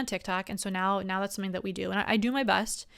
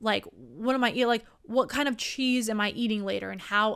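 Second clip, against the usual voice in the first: normal talking voice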